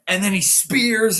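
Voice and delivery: ordinary talk, nothing marked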